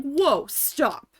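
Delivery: retold sharp protest. Worker halting the screaming manager, retold sharp protest.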